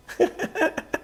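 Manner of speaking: a sudden high laugh that breaks out; high burst of laughter. Real, not acted. To the hosts, laughing at his CrossFit story, a high burst of laughter.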